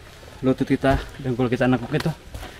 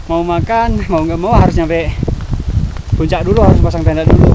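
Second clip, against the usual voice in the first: loud talk over howling wind; shouting over wind; 9 dB louder than usual. Real, not acted. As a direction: keep shouting to be heard outdoors. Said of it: "Semoga saya ngomong kedengeran".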